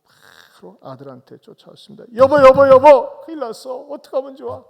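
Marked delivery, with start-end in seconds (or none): 2.1-4.6 much higher, far louder desperate calling; panicked wailing call. Retold or imitated. Wife runs to her husband with the dead baby, panicked screaming. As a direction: scream for your husband in horror.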